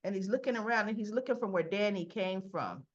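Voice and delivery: ordinary talk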